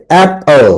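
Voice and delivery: Apple in two beats, first one stressed; syllable stress. Shown as a demonstration. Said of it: "হাততালি দিবে এভাবে".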